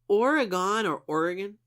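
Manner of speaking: first Oregon ending in a full "gone", second in a weak "gun"; Oregon two ways, full last vowel then reduced; two pronunciations. Shown as a demonstration. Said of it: "I don't know how to say this".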